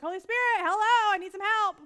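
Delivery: high, loud plea for help; desperate loud cry to the Holy Spirit; calling for help. Retold or imitated.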